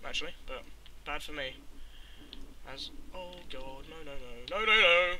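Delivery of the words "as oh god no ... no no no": panicked no no no. Real, not acted. Sudden danger while mining.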